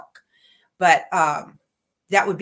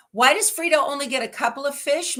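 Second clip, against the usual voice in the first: ordinary talk, nothing marked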